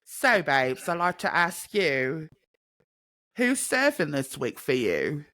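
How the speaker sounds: chatty mock British hosting; put-on London accent. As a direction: ask it in a cheeky London accent. Continues accent to hand over.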